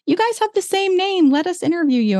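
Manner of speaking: high, mocking reporter voice; chirpy mock interviewer; mocking news outlet. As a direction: parrot the eager reporter way up high. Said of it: "reach out to us and be like".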